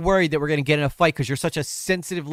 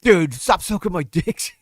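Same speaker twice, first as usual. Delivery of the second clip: a bit louder, sneering mock voice; mocking Nick's take; 5 semitones higher, 7 dB louder than usual. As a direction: sneer it as the whiny guy. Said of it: "Nick thinks that this is Chud sucking him off".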